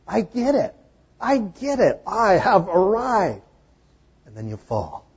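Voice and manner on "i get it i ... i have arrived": high, loud self-satisfied voice; smug new believer. Retold or imitated. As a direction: proclaim it high and pleased with yourself. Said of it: "And you think".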